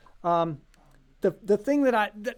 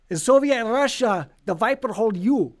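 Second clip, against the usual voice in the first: hammed-up Russian accent, louder and higher; terrible Russian accent; 4 semitones higher, 5 dB louder than usual. Joking to viewers about the Viper, in a terrible Russian accent. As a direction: put on a bad mock Russian accent. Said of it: "that was a terrible Russian accent".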